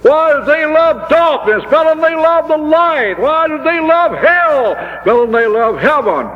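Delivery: high pleading shout to God; anguished cry. Real, not acted. Preacher crying out to God in high anguished shout.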